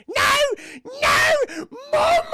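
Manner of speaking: very high, loud mock screams; screaming little girl. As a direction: scream for Mother in acted terror. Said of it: "And she starts screaming".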